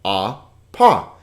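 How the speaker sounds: APA split into ah, pa; syllable by syllable. Shown as a demonstration. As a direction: say apa as two separate syllables, pausing between. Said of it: "so two syllables".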